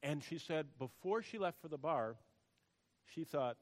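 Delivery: ordinary delivery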